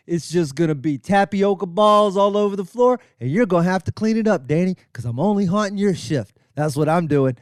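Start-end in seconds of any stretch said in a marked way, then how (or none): none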